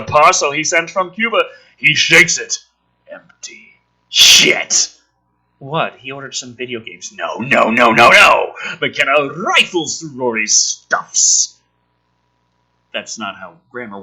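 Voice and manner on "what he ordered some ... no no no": ordinary talk, nothing marked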